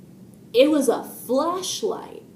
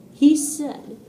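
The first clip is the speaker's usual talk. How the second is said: ordinary delivery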